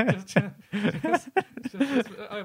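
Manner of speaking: words broken up by laughter; laughing. Real, not acted. Laughing mid-sentence after a joke.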